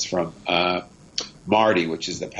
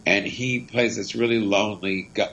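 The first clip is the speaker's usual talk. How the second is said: normal talking voice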